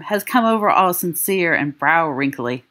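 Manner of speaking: ordinary delivery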